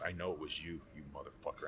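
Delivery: a retold low grumble of blame; mock menacing mutter. Teammate quoting angry quarterback.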